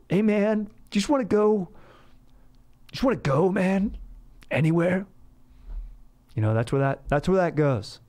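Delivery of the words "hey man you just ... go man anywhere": high, pleading sad-guy voice; hammed-up wistful drunk; mock sad. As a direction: beg a buddy to run away with you. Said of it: "then it's gonna get sad".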